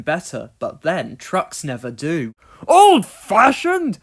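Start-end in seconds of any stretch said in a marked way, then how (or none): 2.7-4.0 slow, very loud, outraged; indignant snort. Acted. Donald insulted by trucks, slow loud indignant snort. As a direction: bark it back, insulted. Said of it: "snorted Donald".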